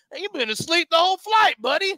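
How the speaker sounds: high, lively mock cowboy; imitated cowboy ribbing a stranger; cowboy impression. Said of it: "there's a cowboy like".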